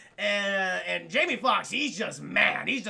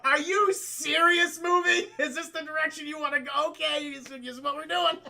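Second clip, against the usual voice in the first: incredulous; 8 semitones higher, 4 dB louder than usual. Real, not acted. Streamer reacting to the film, incredulous. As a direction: act stunned at the movie. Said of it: "I'm like".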